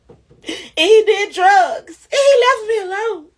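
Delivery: high, tearful mock wail; hammed-up sobbing complaint, high-pitched; mock bitter wailing. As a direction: cry it out loud like a bitter, wronged woman. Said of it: "I could have been like that".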